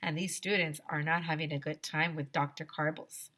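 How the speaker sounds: ordinary delivery, nothing marked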